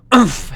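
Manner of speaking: short, high grunt of impact. Acted. Hero slammed against the cavern wall, grunting. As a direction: grunt as the wind is knocked out. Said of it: "he grunted".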